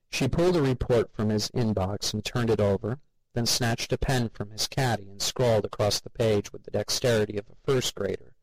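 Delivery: ordinary delivery, nothing marked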